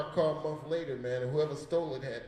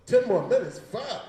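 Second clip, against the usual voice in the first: louder, higher disbelief at the time left; real surprise, a loud groan; surprised; 7 semitones higher, 9 dB louder than usual. Real, not acted. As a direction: caught off guard by the time left.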